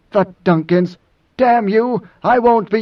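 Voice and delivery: indignant outburst. Acted. Idaho erupts at Moneo's taunt about the Duncans, furious. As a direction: echo it in offence, then explode.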